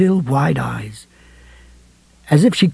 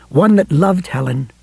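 ordinary delivery

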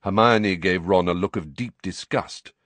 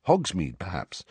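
usual speaking voice

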